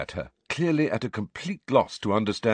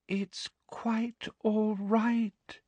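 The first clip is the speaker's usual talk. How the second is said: high, soft, very slow cooing; falsely understanding; 14 semitones higher, 5 dB quieter, much slower than usual. A narrator's reading. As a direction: soothe with syrupy condescension. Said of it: "intended to be an understanding smile".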